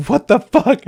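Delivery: high, loud acted shock; mock-appalled exclamation; shocked disbelief. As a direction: act out stunned indignation. Acted quarrel after crying scene.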